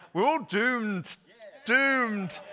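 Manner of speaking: slow, drawn-out mock Scottish doom, high and loud; Private Fraser. Pastor's second go at Fraser's line, slow hammed-up Scottish wail. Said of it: "I can't say it in the Scottish accent".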